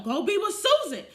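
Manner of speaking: sassy retold brush-off; mock dismissive yell. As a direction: wave him off to Susan.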